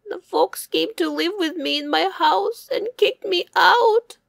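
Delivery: high, loud, tearful hare complaining; upset hare. Acted. Hare telling wolf he was kicked out, high and tearful. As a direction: wail it out like a hurt little hare. Hare tells of losing home.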